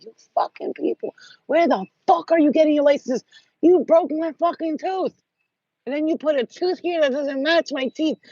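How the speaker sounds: cursing them out, pitch jumping high; furious at the dentists, voice climbing; retold angry rant. Yelling at the dentists over a broken tooth, angry high rant. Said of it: "Motherfucker, I was cursing him".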